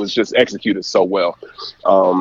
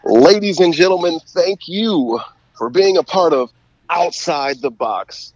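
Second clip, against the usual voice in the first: announcer voice; 7 semitones higher than usual. Shown as a demonstration. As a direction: boom it like a stadium announcer. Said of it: "goal call voice of yours".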